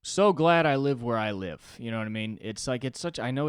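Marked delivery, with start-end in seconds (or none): 0.0-1.8 loud, high, delighted sigh of relief; gushing relief. Retold or imitated. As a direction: glad to be home. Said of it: "just be like".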